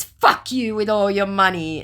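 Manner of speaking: mock scammer taunt. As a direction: sneer it as the scammer. Voicing scammer's message.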